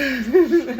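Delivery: sudden loud high laugh; loud burst of laughter. Real, not acted. Laughing at ex's drama.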